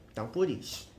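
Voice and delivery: ordinary talk, nothing marked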